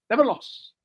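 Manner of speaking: Keith Jackson impression. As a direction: call it like an old college football broadcaster, lightly done. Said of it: "Was that your Keith Jackson, uh, accent there? Impersonation?".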